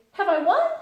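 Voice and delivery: high, loud, cheery question; cheerful. A narrator's reading. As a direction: ask it bursting with cheer. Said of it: "said cheerfully".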